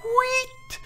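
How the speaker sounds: tiny squeal way up high; high-pitched squeak. Acted. Droid emitting a high-pitched squeak, tiny squeal. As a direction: squeak it out like a toy. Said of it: "emitted a high-pitched".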